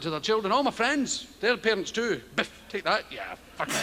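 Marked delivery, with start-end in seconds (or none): 2.4-3.7 a hint of a mock thumping parent, fading to a low growl; violent parent. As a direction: mime the beating with mock menace. Parents hitting children, retold.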